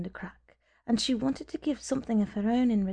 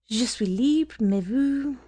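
a touch higher and louder, eager; excited French; 3 semitones higher, 5 dB louder, much slower than usual. A narrator's reading. Woman accepts his dinner invitation, excited and a bit louder. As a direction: let the excitement tip into French. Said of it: "Excitement made her lapse into French".

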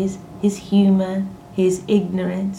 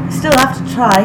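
high, loud, strained gallantry; pitched up, eager and tense; tensely gallant; 6 semitones higher, 8 dB louder than usual. A narrator's reading. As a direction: play the gallant, a little tense. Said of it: "rather tensely gallant".

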